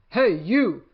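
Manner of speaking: loud, bossy challenge; picking a fight with a gorilla, loud and grouchy; grouchy ladybug. Acted. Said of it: "said the grouchy ladybug".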